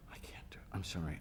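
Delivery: whispered, embarrassed apology; hushed, mock-ashamed admission; sheepish whisper. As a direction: confess it under your breath. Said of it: "call the producers and say".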